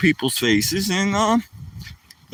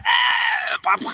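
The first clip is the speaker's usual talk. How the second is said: mock choking; 6 semitones higher than usual. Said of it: "you're going".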